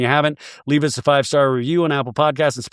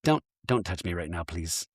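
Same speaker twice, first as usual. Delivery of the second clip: much lower and softer; hushed refusal; 8 semitones lower, 10 dB quieter than usual. Retold or imitated. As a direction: quietly push her away. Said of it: "And Amanda just goes".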